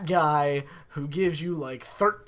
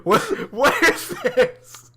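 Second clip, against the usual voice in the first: high, slow laughing question; laughing disbelief; 10 semitones higher, 8 dB louder, slower than usual. Real, not acted. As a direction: laugh out the shock. Reacting to friend's joke.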